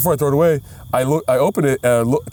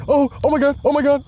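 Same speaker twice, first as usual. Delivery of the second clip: high-pitched mock "oh my God" panic; quoting a startled girl, voice jumping up; imitating girl's alarm; 11 semitones higher than usual. Retelling friend's reaction at dinner, imitated alarm. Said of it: "one of the girls that we were with was just like".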